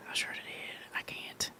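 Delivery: stunned under-breath whisper. Real, not acted. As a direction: breathe it out in disbelief. Shocked aside while stream plays.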